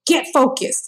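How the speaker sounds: high, commanding doctor voice; imitating the doctor barking orders; doctor giving orders. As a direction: direct the family like a drill sergeant. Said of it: "she directed my mom and she was like".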